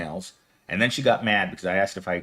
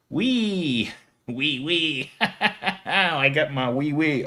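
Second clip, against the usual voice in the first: giggling; 4 semitones higher, much slower than usual. Real, not acted. Giggly silly wee wee talk.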